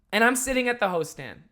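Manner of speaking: usual speaking voice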